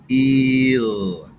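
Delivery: "Eel" drawn out as the last part of heel; drawn-out "Eel" after the h; sound segment. Shown as a demonstration. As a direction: stretch out "Eel". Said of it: "Let's count them".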